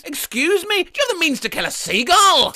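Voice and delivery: a hint of an old lady asking; sweet old lady. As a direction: ask politely like a little old lady. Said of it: "A sweet old lady wandered over and said".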